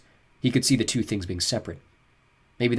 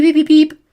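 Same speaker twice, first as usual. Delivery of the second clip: high beeping; 14 dB louder than usual. A narrator's reading. Imitating computer beeps.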